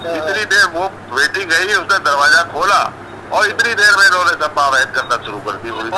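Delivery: normal talking voice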